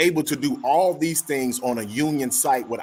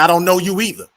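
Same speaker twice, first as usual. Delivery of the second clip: heated retort; 6 semitones higher, 8 dB louder than usual. Retold or imitated. Heated retort in retold argument.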